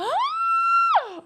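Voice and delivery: very high, loud gasp of excitement; excited gasp. Real, not acted. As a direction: gasp as the realization hits. Recognizing the Cyberpunk lip color.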